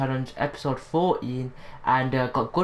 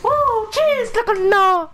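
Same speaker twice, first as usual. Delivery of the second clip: loud, piercingly high falsetto whoop; excited squeal; 6 dB louder than usual. Pretend. Gamer celebrating mid-match, excited squeal.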